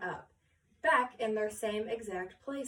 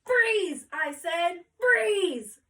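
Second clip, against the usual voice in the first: furious yell, much higher and louder; 8 semitones higher, 8 dB louder, much slower than usual. Acted. Photographer yelling at the class to hold still, loud yell. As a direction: bellow at the frozen class to freeze. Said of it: "The photographer yelled so loud".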